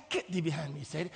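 low, hushed banishing voice; mock rebuke of Satan. Believer mistakes God's nudge for the devil, low muttered rebuke. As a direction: mutter it low like warding off temptation.

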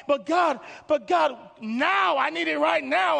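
high, loud, drawn-out insistence; frustrated demand. Acted. Believer demanding God act now, frustrated shout. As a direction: shout it like it cannot wait. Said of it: "you're getting frustrated with life".

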